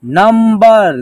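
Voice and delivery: measured reading-lesson delivery, long held syllables; word-by-word pronunciation drill. Shown as a demonstration. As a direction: stretch each word for learners to repeat. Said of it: "एक साथ उच्चारण करेंगे".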